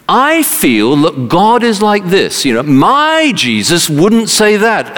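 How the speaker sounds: high, slow, emphatic mock objector; imagined objector. Preacher quoting someone outside who will tell you, high-pitched emphatic mimicry. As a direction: lightly act out an opinionated objector, pitched high. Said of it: "someone says, will tell you".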